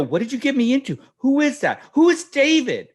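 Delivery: high-pitched, rapid-fire parental questions; retold flustered interrogation; incredulous grilling. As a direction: demand answers in mock panic. Retelling interrogation of daughter.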